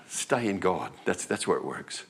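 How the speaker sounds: ordinary talk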